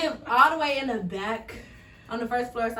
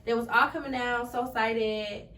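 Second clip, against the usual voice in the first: ordinary talk, nothing marked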